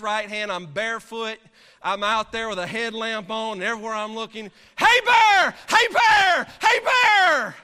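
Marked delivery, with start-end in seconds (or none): 4.8-7.6 repeated yelling. Retold or imitated. Shouting into fog at bear.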